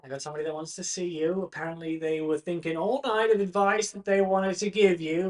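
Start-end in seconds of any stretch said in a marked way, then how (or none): none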